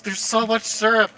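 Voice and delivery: roleplayed scrubbing. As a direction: fret over the mess as you scrub. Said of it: "have to roleplay".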